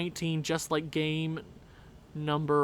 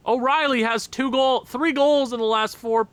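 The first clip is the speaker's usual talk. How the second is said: excited; 6 semitones higher, 5 dB louder than usual. Real, not acted. Excited stat after goal.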